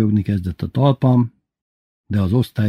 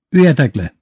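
loud, higher-pitched order; barked command; 5 semitones higher, 5 dB louder than usual. A narrator's reading. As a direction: order the class down. Teacher drilling the class. (hangos, kurta parancs)

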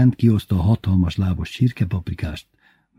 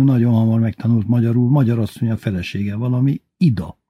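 plain reading voice

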